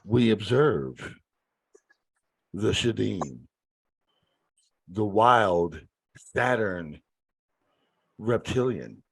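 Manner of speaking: slow hushed nature narrator; nature documentary. Pretend. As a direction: narrate him like a wildlife show. Nature documentary parody of co-host.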